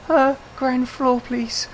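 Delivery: put-on high, slow startled girl's voice; startled woman answering politely; timid girl. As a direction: squeak it out like a flustered young woman. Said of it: "this poor girl went".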